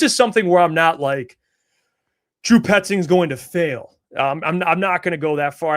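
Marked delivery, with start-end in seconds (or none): 2.4-3.9 slow, loud, raised-pitch declaration; mock-emphatic pronouncement, each word weighted; mock verdict. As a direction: proclaim it loudly and slowly, as a hypothetical hot take. Mock doom verdict on coach.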